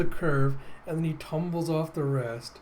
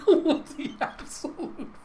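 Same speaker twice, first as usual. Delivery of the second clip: high, airy words through laughter; laughing in disbelief; 13 semitones higher than usual. Real, not acted. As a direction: barely get it out for laughing. Helpless laughter at absurd story turn.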